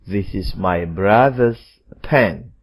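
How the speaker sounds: slow, careful teacher's example; slow modelling voice; slow model reading. Shown as a demonstration. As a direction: read it slowly so students follow. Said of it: "Tetapi pada nomor lima".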